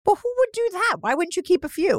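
can't believe they gave them all away; shocked disbelief. Real, not acted. Co-host stunned that every covid test was given away.

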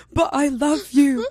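slow, drawn-out mock pleading; mock crying boyfriend. Crying boyfriend begging after cheating, mocked pleading. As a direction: whine it slowly, imitating a tearful ex. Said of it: "He started crying".

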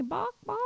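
high, playful chicken clucking; mock chicken clucks. Joking about the Easter Bunny, high mock chicken clucks.

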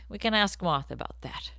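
hushed unvoiced aside; whisper. Real, not acted. Side comment to listeners in a whisper. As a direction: drop to a whisper.

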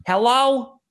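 high, loud answering-the-phone hello; mimicked phone greeting; phone pickup.

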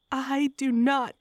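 defiant possessed howl. A narrator's reading. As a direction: howl it like a spirit has you. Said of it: "I howled with Martinos".

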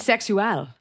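Bisexual said the Spanish way, bee-sek-SWEL; Spanish pronunciation. Shown as a demonstration. Answering how to say it in Spanish, Spanish pronunciation. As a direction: pronounce Bisexual like a Spanish word. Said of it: "How do you say bisexual in Spanish?".

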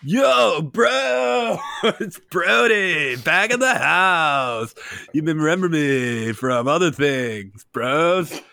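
bro voice. Acted. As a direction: hype frat bro shouting. Brody bro character intro.

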